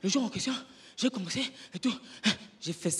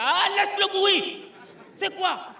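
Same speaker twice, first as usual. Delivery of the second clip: very high, slow mocking heckle; a heckler shooing the comic off; 13 semitones higher, 6 dB louder, much slower than usual. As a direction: drag it out to brush him off. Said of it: "heckler repeated".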